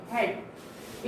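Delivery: हेड breathed softly with a short e. Shown as a demonstration. Teacher whispering the correct head. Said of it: "हेड बोलते हैं इसे".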